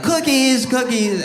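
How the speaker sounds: put-on high sing-song little girl hawking cookies. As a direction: chirp it out like a kid selling cookies. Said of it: "And like".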